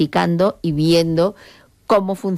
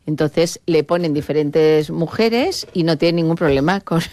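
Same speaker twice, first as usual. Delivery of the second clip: ordinary talk, nothing marked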